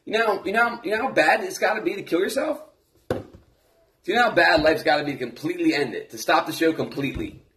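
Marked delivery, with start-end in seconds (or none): none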